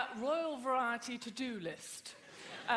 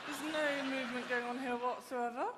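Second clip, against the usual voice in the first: upper-class British, mouth barely moving; posh closed-mouth speech. Shown as a demonstration. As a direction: talk posh British with the mouth nearly shut. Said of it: "speak completely with their mouths closed".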